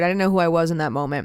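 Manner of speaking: ordinary talk, nothing marked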